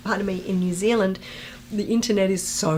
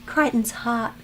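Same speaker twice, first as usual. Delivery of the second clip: normal talking voice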